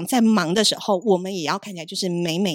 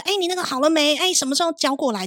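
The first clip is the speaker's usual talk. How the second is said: higher-pitched newsroom nagging, imitated; noisy market clamour; 6 semitones higher than usual. Colleagues chasing copy in a busy newsroom, mock market clamour. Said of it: "像菜市场".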